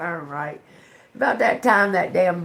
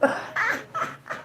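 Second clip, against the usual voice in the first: breathy high laugh; 6 dB quieter than usual. Real, not acted. After mock wail.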